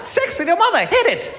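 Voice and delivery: put-on high, happy New Zealand accent, lilting up; two cheery Kiwis, upbeat whatever the news. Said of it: "still sound happy".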